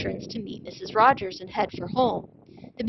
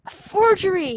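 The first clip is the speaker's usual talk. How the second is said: loud, high cry of alarm; a museum director yelling at a fake; shouted; 10 semitones higher, 5 dB louder than usual. A narrator's reading. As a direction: shout it out in shock. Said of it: "he shouted".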